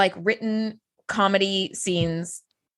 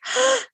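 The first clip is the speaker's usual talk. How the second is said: delighted gasp; 11 semitones higher than usual. Retold or imitated. Discovering sushi.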